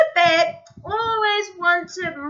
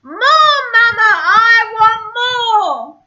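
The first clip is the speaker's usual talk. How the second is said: loud, much higher demanding yell; 7 semitones higher, 9 dB louder than usual. Acted. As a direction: scream for more like a spoiled cub. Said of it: "started yelling".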